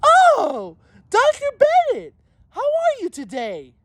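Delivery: squeaky high, sugary greeting, hammed up; mocking fake-sweet teacher. Mocking the teacher greeting Doctor Bennett, squeaky fake-sweet voice. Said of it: "this is what the dumbass bitch did".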